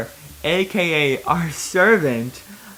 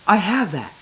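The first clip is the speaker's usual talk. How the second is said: higher, louder eager line; excited; 6 semitones higher, 5 dB louder than usual. Acted. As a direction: blurt it out excitedly. Excited character line after gasp.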